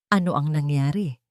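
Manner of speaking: ordinary delivery, nothing marked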